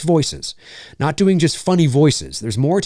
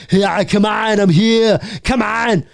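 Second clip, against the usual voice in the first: a hint of Arnold, hammed-up; lazy Arnold catchphrase; 7 semitones higher, slower than usual. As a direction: fake an Arnold line badly. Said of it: "not an Arnold Schwarzenegger impression".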